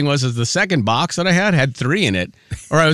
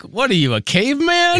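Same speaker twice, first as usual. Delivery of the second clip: mock indignation; 11 semitones higher than usual. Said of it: "I'm like".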